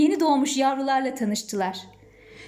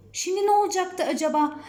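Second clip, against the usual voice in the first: ordinary delivery, nothing marked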